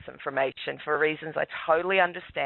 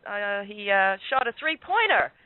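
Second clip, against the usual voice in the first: higher-pitched retold cheer; cheerful; 6 semitones higher than usual. Dad bragging to cops about three-pointer, cheerful. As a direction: chirp it as a proud dad retelling. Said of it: "I cheerfully say".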